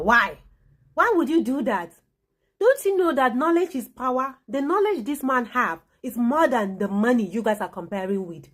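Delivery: ordinary talk, nothing marked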